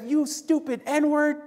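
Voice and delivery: put-on high, slow, sneering insult at a kid; mock angry slur. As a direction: spit it out like an angry driver. Driver swears at running kid.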